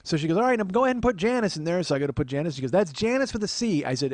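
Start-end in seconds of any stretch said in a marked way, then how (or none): 0.4-1.8 high, loud imitated aunt; bossy aunt. Aunt telling nephew whom to add, bossy aunt voice. 2.7-3.8 high, insistent imitated aunt; helpful aunt. Aunt reading names out to nephew, fussy aunt voice.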